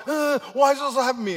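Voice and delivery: high, loud, hurried wail of complaint; mock distressed believer. Overwhelmed believer complaining to God, mock loud wail. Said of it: "And you're like".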